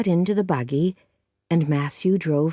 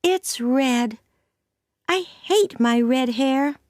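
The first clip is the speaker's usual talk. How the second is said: put-on higher, mildly sorrowful child; sad girl voice; 7 semitones higher, slower than usual. Anne complaining to Matthew about her hair. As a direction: hate it, a little sulky. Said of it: "said the little girl sadly".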